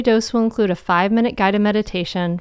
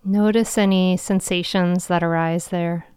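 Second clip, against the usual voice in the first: guided meditation. Acted. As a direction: guide them gently into calm.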